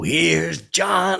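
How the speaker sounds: a hint of the drawn-out host intro; lightly voiced announcer. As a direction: coolly call out the intro. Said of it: "I was so cool".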